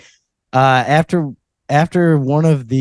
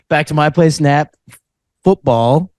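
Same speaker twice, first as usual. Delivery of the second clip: bro list voice. Retold or imitated. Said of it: "him going".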